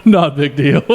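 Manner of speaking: laughing reply. Real, not acted. Storyteller teased about his size, laughing as he answers. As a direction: let laughter break up the reply.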